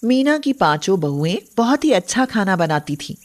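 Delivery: usual speaking voice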